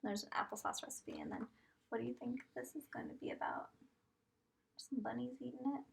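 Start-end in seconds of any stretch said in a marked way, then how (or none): 1.9-3.7 whisper. Acted. Teacher whispers a guessing question. 4.8-5.8 whisper. Acted. Whispered hint while reading.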